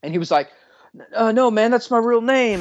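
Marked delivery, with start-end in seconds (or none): 1.1-2.6 imitating a nervous man, higher pitch; mock nervous insistence; nervous protest. Driver protesting to the cop, mildly nervous. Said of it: "he's, like, getting nervous".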